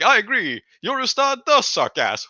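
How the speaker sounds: high, loud, gleeful mock patron; mock patron agreeing. GM mocking the patron who sided with the player, high and loud. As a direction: crow it in a high, amused voice. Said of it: "their patron was like".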